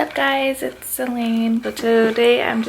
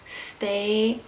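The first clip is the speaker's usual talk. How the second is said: ordinary talk, nothing marked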